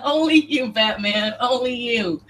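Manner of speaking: laughing through the words. Real, not acted. Streamer cracking up at a chat joke, laughing. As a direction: giggle it out.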